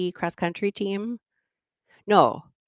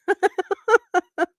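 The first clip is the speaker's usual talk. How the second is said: high laugh; 5 dB louder than usual. Real, not acted. Laughing at own videos.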